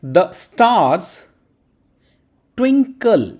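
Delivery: "The stars twinkle" spaced out, rising high on "twinkle"; emphatic dictation. Shown as a demonstration. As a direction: dictate it slowly and clearly. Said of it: "कैसे लिखा जाएगा English में".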